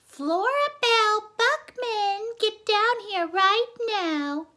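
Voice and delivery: high loud calling down the stairs; slow stretched yell from below; mother shouting. Acted. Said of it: "her mother shouted".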